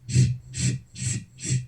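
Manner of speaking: repeated shushing scrape; short, even shh sounds mimicking dragged feet; imitated tracking noise. Family listening downstairs to the noise, imitated as shushing. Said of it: "this weird tracking noise".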